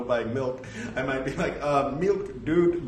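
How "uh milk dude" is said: put-on Indian accent. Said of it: "So if I ever go back to California".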